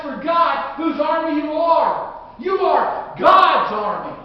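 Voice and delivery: rally the congregation like a battle cry; fiery preaching. Acted. Preacher rousing his flock to remember whose army, shouting.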